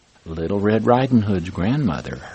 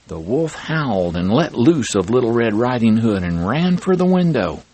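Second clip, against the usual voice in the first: plain reading voice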